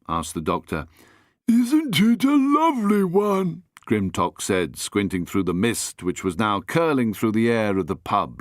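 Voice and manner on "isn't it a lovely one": high, pleased character voice; Grimtock's voice. Acted. Grimtock admires the hat, high and pleased. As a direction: Grimtock fondly shows off the hat.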